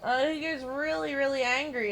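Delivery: ordinary talk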